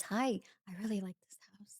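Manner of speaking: put-on soft breathless whisper of a timid girl; young girl's request. Girl at the open house asks quietly, whispered. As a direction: hush it like a shy young buyer. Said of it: "She goes".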